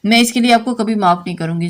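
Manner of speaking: usual speaking voice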